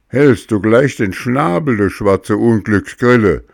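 put-on loud, angry puppet boy; scolding. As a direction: snap at the cricket. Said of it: "schimpfte Bengele".

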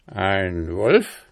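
ordinary delivery, nothing marked

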